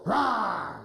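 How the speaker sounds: a light playful rawr; mock wolf growl. Shown as a demonstration. Coach showing the wolf a silly growl. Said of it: "Wolf, can you go".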